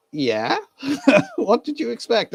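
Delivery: high laughing yeah, then a teasing question; laughing retort. Real, not acted. Retelling the Canadian friend, laughing and teasing. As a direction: laugh it off in disbelief.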